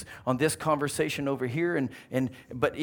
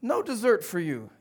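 higher-pitched, indignant telling-off; acted stern father, voice raised in pitch; scolding dad; 9 semitones higher, 3 dB louder than usual. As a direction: send your boy to his room. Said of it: "his dad stands up, says".

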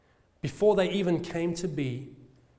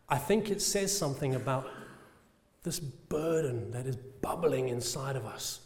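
ordinary talk, nothing marked

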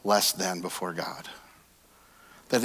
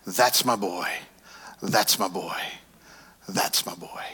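proud father, softly; 6 semitones lower, 3 dB quieter, slower than usual. Retold or imitated. Father cheering his son at the game, softening to a whisper. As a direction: lower and fading, each take softer to a whisper. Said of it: "I kept hearing the same thing".

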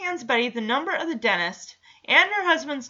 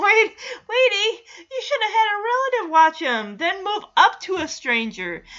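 high, louder exasperated scolding; 8 semitones higher, 4 dB louder than usual. Real, not acted. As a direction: scold her in disbelief. Scolding the character.

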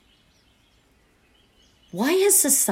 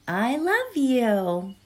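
1 very slow tender; 5 semitones higher, much slower than usual. Shown as a demonstration. Affirmation, very slow.

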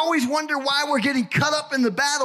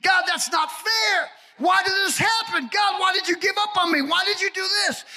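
raised-voice mock protest to heaven; complaining to God; 7 semitones higher than usual. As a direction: cry out at God like a wronged child. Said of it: "We sometimes do that with God".

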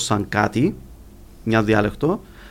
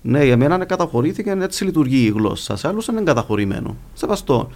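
ordinary delivery

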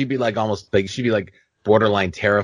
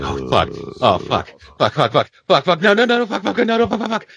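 mounting panic; 11 semitones higher than usual. Retold or imitated. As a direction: replay panicked cursing, pitch rising steeply. Acts out rising panic while frozen.

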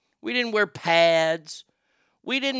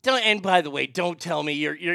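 usual speaking voice

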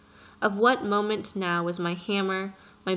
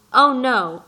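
loud, higher protesting "Oh, no!"; cried out; 4 semitones higher, 10 dB louder than usual. A narrator's reading. Pilot cuts off the prince, loud protest. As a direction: cry it out to stop him. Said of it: "I cried".

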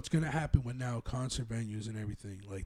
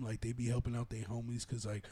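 ordinary talk, nothing marked

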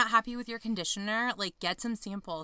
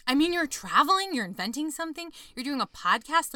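excited; 7 semitones higher, 6 dB louder than usual. Real, not acted. Listing Sagittarius adventures excitedly.

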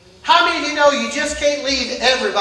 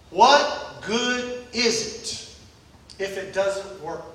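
drawn-out, very slow, much softer What good is it; slow quiet question; 6 semitones lower, 8 dB quieter, much slower than usual. Acted. Preacher asking the church what good it is, slow and hushed. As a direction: let each word of What good is it if it doesn't work sink in.

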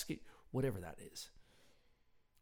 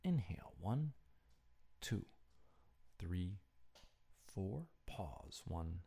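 guided breathing; 5 dB quieter than usual. Real, not acted. Host leading listeners to breathe before sleep, guided meditation. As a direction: count the breaths softly and evenly.

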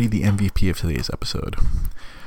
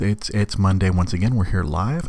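ordinary talk, nothing marked

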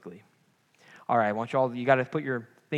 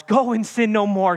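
"Go and sin no more" lifted high and loud; rousing and joyful; 6 semitones higher, 7 dB louder than usual. Shown as a demonstration. Preacher proclaiming freedom to the congregation, lifted high.

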